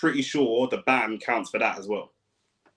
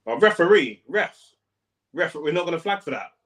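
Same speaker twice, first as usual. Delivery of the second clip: protest at the ref; 5 semitones higher than usual. Real, not acted. Protesting a foul in the match.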